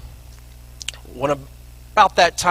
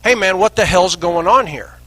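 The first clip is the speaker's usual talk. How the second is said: mild mock yell, higher pitched; retold yell; 6 semitones higher, 3 dB louder than usual. Young agent challenges the smuggler across the river, retold yell. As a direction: imitate an agent yelling, without full volume. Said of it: "He yells at me".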